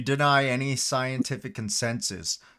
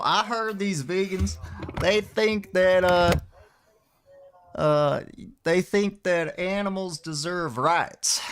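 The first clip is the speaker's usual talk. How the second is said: higher-pitched, hammed-up sceptic with a Western twang; Kevin; 7 semitones higher than usual. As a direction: rile the vegans in a put-on country voice. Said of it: "My name is Kevin".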